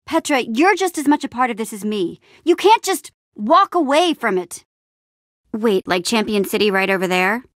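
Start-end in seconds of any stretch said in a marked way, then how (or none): 2.4-4.6 higher, insistent plea; urgent protest. Acted. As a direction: push back hard on a friend. Urging Petra not to leave.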